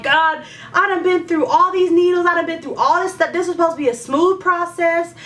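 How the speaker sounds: high, indignant protest to God; a hint of entitled whining; prideful complaint. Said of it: "a place of, I guess, pride".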